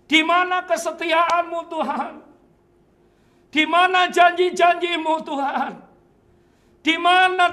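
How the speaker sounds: loud, high pleading at God; shouting at heaven in despair; anguished shouted prayer. Retold or imitated. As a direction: demand answers, voice strained. Acted prayer rising to anguish.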